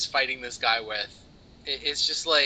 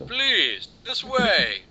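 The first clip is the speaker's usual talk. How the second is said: higher, louder lightly creepy butler; 8 semitones higher, 4 dB louder, much slower than usual. Pretend. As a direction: usher them in like a creepy butler. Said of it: "He looks like a really creepy butler".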